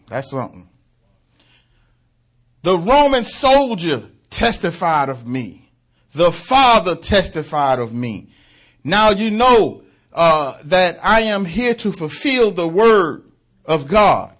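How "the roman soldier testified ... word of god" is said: high, loud proclaiming voice of Christ; voicing the Son of God. Preacher voicing Christ at the door, loud proclaiming. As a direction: declare each line like a king.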